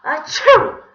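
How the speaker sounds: high, very loud fake sneeze; big theatrical achoo; explosive sneezes. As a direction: sneeze violently, twice over. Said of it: "he began to sneeze".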